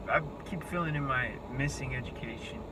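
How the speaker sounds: ordinary talk, nothing marked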